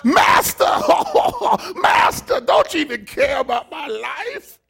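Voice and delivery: high, loud laughing cry of master; fired believer shouting his plea to God; mock panicked plea. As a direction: plead in a hammed-up panic. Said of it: "Why you do this to me?".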